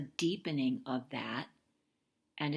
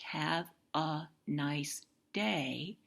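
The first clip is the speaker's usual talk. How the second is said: very slowly; slower than usual. Shown as a demonstration. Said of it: "very slowly".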